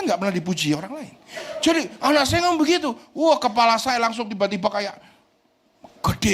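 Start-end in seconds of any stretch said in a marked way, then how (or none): none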